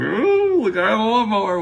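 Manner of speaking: high-pitched "oh" of discovery; excited recognition. Retold or imitated. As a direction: squeal it as the clue clicks, hammed up. Acts out spotting a known clue.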